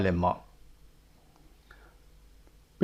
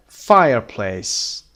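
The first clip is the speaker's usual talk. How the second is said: "Fireplace" slowly, "Fire" punched; first-syllable stress; 5 dB louder, much slower than usual. Shown as a demonstration. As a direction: hit FIRE in "Fireplace", drawn out. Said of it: "The first syllable is stressed". («Fireplace» آهسته با تکیه روی بخش اول)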